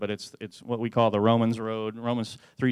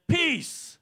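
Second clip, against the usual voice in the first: excited cry. Retold or imitated. Dad crying out peace.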